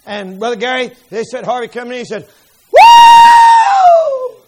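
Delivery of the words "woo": very high, loud whoop of joy; joyful woo shout. Retold or imitated. Father back from the woods whoops with joy. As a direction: let out a wild triumphant whoop.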